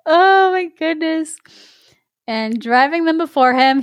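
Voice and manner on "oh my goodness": slow, high oh my goodness; mock scandalized. Real, not acted. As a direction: act shocked. Scandalized reaction.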